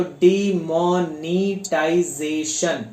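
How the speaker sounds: डिमोनेटाइजेशन said slowly, each syllable drawn apart; slow syllable demo. Shown as a demonstration. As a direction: stretch every syllable out. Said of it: "four या four plus की बात करते हैं".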